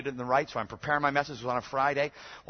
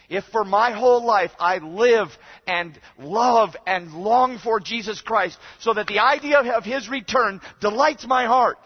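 loud, high, drawn-out proclamation; impassioned preaching; 10 semitones higher, 14 dB louder, slower than usual. Real, not acted. Preacher to congregation on longing for Christ, impassioned. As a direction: preach it with slow, rising force.

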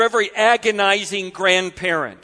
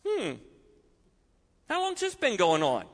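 softer, unhurried question; calm; 7 dB quieter than usual. Acted. Jesus calmly asking the father about his son, soft. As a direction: ask it gently and calmly. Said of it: "Jesus says kind of calmly".

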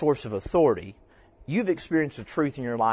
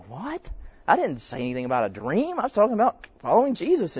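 baffled; 7 semitones higher than usual. Retold or imitated. Pastor replaying his own confused thought at her answer, high and surprised. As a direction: act thrown off by the dream talk.

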